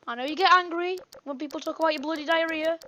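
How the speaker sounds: ordinary talk, nothing marked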